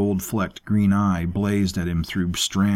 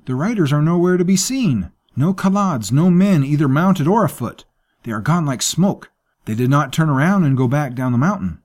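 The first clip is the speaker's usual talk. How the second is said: frightened servant, much higher and louder; alarmed servant Safik; 8 semitones higher, 5 dB louder than usual. Acted. Servant tells his master the riders vanished, high and alarmed. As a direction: insist the riders vanished, rattled.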